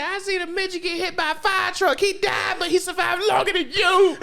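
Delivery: high-pitched comic scolding; mock outraged rant. Mom comparing her dead son to others, mock outrage. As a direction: scold high, cracking up at the end.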